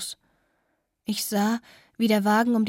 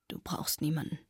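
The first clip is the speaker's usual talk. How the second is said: low, soft whisper; murmured; 5 semitones lower, 8 dB quieter than usual. Acted. Weeping Anne to the girl, soft low murmur. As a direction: barely breathe it out. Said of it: "murmelte sie".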